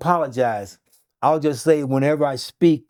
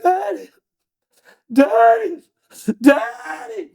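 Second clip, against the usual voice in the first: very high, drawn-out cry for Daddy; high-pitched imitated screaming; 4 dB louder, slower than usual. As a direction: scream it like a daughter waking up. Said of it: "She screamed, "Daddy," so loud".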